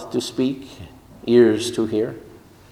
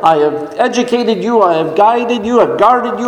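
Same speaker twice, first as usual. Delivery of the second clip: incredulous, high-pitched rebuke piling up grievances; angry God; 7 semitones higher than usual. Acted. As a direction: reproach him indignantly, higher and higher, as an exasperated God. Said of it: "The anger of the Lord burned against Moses".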